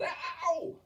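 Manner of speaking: high, loud yelp of pain; yelling. A narrator's reading. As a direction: yelp as if stung. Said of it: "he yelled".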